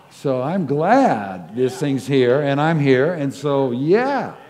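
loud, jubilant proclamation of gladness; grateful traveller shouting his joy at the cancellation; joyful shout. Acted. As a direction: belt it out with delight. Man praising God over cancelled flight.

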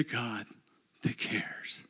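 softly whispered ending. Acted. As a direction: let it fall to a whisper. God's words, whispered end.

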